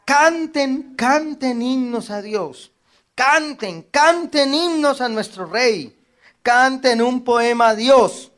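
high, loud preacher's proclamation; fervent scripture reading. Real, not acted. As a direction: proclaim the psalm to the congregation. Reads psalm fervently.

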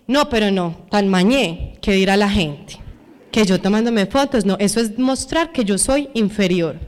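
lightly acted snobbish reluctance; self-conscious refusal. Voicing the proud, self-conscious objection.

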